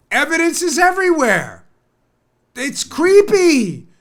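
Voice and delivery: loud, high, incredulous rant; worked-up, high-pitched disbelief; exasperated rant. Real, not acted. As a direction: stretch the key words, high and loud. Ranting about creepy behaviour.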